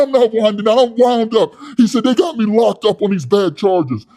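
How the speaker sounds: high, loud, puffed-up yelling; loud hothead Wes. Retold or imitated. Wes scolding his soy boy cellmate, loud and high. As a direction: rant like a tough guy with something to prove. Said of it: "wind down".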